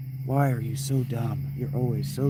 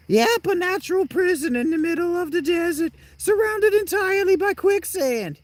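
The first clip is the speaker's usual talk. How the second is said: very high falsetto figure; character impression. Game character describing the desert prison, high squeaky voice. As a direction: hold a high, squeaky cartoon voice. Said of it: "That's how he talks".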